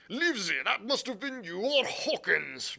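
high, loud, agitated outburst; accusing cry. A narrator's reading. Squire blaming the doctor for the leak, crying out. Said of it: "cried the squire".

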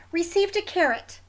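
ordinary delivery, nothing marked

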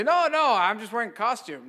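high, loud hammed-up denial; mock protest. Priest imagining he denies his collar, high mock protest. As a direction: deny it in an exaggerated high voice.

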